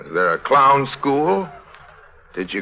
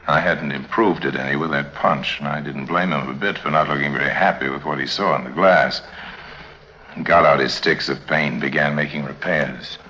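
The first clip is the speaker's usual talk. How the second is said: story narration; 8 semitones lower, 4 dB quieter than usual. A narrator's reading. [name] narrating to the listeners, low reflective narration. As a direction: tell it like a weary private eye.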